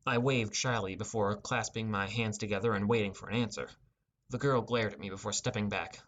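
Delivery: ordinary delivery, nothing marked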